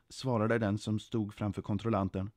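ordinary delivery, nothing marked

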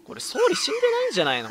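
very high, loud, quick squeal of suspicion; amused disbelief. Real, not acted. Streamer doubting the prime minister is dead, amused disbelief. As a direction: blurt it out, pitch leaping up. Streamer suspects a character survived.

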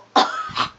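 very high, loud burst of laughter; shrieking giggle at the kill; squealing laugh. Real, not acted. Gamer shrieks with laughter at a good shot.

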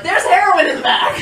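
high, hammed-up shout; bold mock confession. Smitten driver calling out to the border guard, high mock shout. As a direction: holler it out as if brave enough. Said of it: "didn't have the balls to be like".

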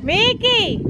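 high, sing-song call of the dog's name. Real, not acted. Calling the crying dog. (llamando a Mickey con voz aguda y cantarina)

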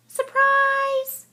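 high, loud cheer; joyful shout. Acted. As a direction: burst it out for a friend. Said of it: "shouted Mole".

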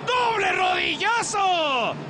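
excited shouting play-by-play; wrestling commentator. Real, not acted. Wrestling match call, double knee strike.

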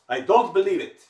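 disbelieving outburst. Retold or imitated. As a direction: blurt it out loud in open disbelief. Heckler stands up, objects.

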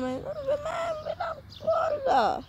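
acted crying, high and slow; a hammed-up sobbing plea. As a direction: wail it out in fake tears. Captive girl whines, heard crying.

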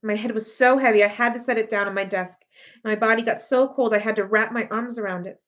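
usual speaking voice

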